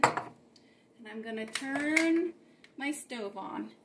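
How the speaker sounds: ordinary talk, nothing marked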